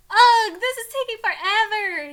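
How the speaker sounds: high, loud whiny groan; supposedly nonchalant. A narrator's reading. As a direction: complain with exaggerated impatience. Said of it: "he says nonchalantly".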